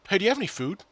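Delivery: high-pitched imitation of a teammate asking; mimicked teammate question. Teammate asking the speaker for food, mimicked high. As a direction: chirp the question as the other player.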